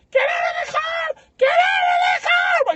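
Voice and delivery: very high, drawn-out mock scream; screaming bystander. Feminist urging sister from the car, imitated screaming. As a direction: shriek each word slowly, hammed-up urgency.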